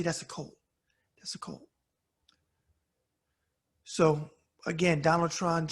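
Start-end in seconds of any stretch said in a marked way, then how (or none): 0.0-1.7 hushed unvoiced whisper. Real, not acted. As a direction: drop to a whisper to drive it home. Angry rant about Trump's cult.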